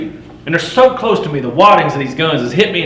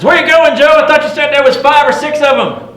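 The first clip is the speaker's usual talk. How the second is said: high, loud holler at a passing rider; yelled question; 13 semitones higher, 6 dB louder than usual. Acted. Old trapper yelling at Joe as he races past, loud holler. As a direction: shout it up high as he rides by. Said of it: "he yells up at me".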